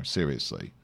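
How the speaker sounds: ordinary talk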